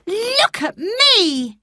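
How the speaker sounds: high, slow, proud cry; triumphant boy. Acted. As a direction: show the world he stands. Colin triumphant.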